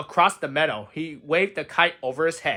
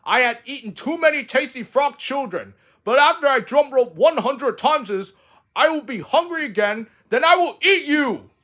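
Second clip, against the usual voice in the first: high, loud gloating ghost voice; the dark frog taunting little Frog, loud and gloating; menacing dark frog; 8 semitones higher, 5 dB louder than usual. Acted. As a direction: gloat over your victim. Ghost frog threatens child.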